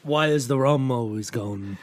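put-on slow Liverpool-accented pirate; pirate impression slipping into Liverpudlian; Beatle-like pirate. Host attempting the film quote, slow Liverpool accent. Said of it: "I'm just sounding like a beetle".

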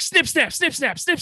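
sing-song snip snap, mimicking him; Michael Scott. Fan comparing trade chaos to The Office, mock sing-song. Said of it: "Michael Scott's like".

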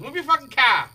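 put-on Boston accent. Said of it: "Boston bit continued".

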